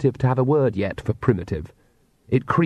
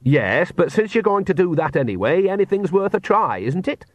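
higher-pitched, easygoing rustic voice; unruffled old witch Nanny; 8 semitones higher than usual. A narrator's reading. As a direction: reason mildly in the face of panic.

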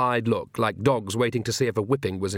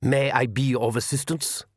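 lightly voiced formal vampire; courteous vampire. A narrator's reading. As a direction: offer help with smooth, formal politeness. Vampire blocks their way politely.

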